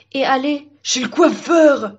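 slow, drawn-out eager cry; overexcited tree butting in; excited interjection. Acted. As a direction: blurt it out, stretched with glee.

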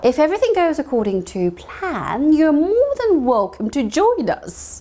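walk learners through it slowly; slow teaching model; slow model sentence. Shown as a demonstration. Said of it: "다시 한번 영어로 말하면서".

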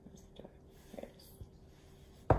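hushed aside; soft whispered aside. Real, not acted. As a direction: whisper it to the class.

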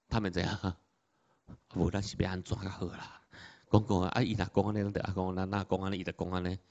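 conspiring murmur in Taiwanese; muttering in Taiwanese. Retold or imitated. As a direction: play the Pharisees whispering among themselves in Taiwanese. Pharisees mutter, plotting their answer.

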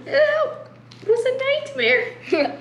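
high, slow, laughing groan. Real, not acted. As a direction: drag it out through laughter. Laughing after sour candy.

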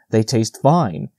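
ordinary delivery, nothing marked

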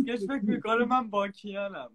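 normal talking voice

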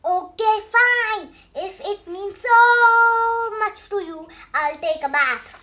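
loud, high, dragged-out surrender; grudging give-in. Acted. Pigeon caving in to the bath, loud and whiny.

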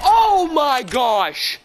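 drawn-out shocked cry. Real, not acted. Big moment in game.

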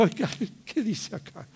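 retold bafflement at one's own writing; puzzled reader. Mathematician puzzling over his own book, mildly baffled. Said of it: "me miraba como diciendo".

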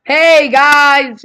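a loud, high "Hey guys" blasted out; too loud. Shown as a demonstration. Vlogger showing how loud talk sounds, loud and high. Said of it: "I'm not gonna talk like".

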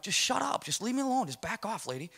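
high-pitched, exasperated brush-off without shouting; retold irritated quarrel. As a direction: fend off a nagging partner. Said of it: "we get in this big fight".

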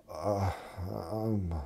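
faint, hesitant mumble with long gaps; confused patient. Acted. Confused patient failing to answer the paramedic, dazed. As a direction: act dazed, not tracking the question. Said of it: "they're confused".

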